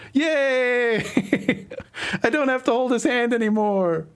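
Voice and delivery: over the moon with relief, squeal it out; thrilled. Acted. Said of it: "And then he's just, like, thrilled. He's over the moon".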